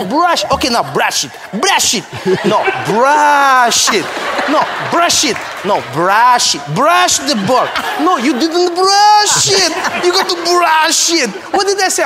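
high, loud, slow barked commands; repeated shouted orders. Retold or imitated. The coach, refusing to change his instruction, keeps shouting at Trevor. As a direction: hammer the word brush at him. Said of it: "He just keeps saying".

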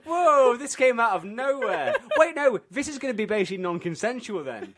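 high, loud shocked laughing. Real, not acted. Shocked reaction to witch twist.

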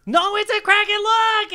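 high, insistent cry; urgent shouted warning. Acted. Geppetto to Jacques about the Kraken, urgent shout. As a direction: shout to make the doubter look. Geppetto warns doubting crewmate of monster.

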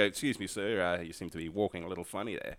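hushed, quick, overly polite staff voice; polite airport staff. Retold or imitated. Airport staff quietly questioning a passenger, soft and quick. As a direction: murmur it softly and fast like discreet airport staff. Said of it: "walk up to you and go".

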